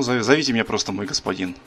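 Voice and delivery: ordinary delivery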